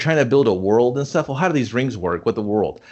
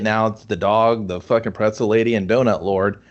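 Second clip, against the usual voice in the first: normal talking voice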